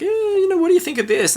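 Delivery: high, hammy schoolboy voice; boys asking. Retold or imitated. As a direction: ask it in a silly high voice. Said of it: "they're like".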